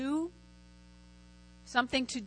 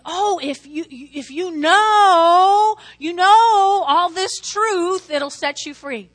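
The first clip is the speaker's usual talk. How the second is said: naive reading; 5 semitones higher, 8 dB louder, much slower than usual. Retold or imitated. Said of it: "When I was just a new believer".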